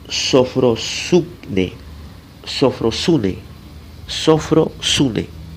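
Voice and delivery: "sofrosune" stretched out slowly, syllable by syllable; slow dictation. Shown as a demonstration. Preacher teaching the Greek word "sofrosune", very slowly. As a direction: drag out each syllable of "sofrosune". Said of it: "S O F R O S U N E".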